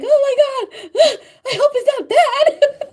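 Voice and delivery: very high, loud squeal with a gasp and laughter; a high laughing squeak of panic; mock panic. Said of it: "And I'm just like".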